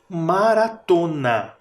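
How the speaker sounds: maratona spoken slowly for the rhyme; slow demo word. Shown as a demonstration. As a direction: draw out maratona so the ending is heard. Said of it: "E por último".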